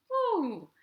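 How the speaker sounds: high falling "woo"; woo cheer. Acted. Storyteller to dancing children, high cheering whoop. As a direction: whoop as the dance ends. Cheer ending the dance verse.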